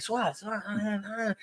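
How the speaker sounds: high, soft, halting stammer; can't get the words out. Retold or imitated. Young cousin trying to break bad news, high halting stammer.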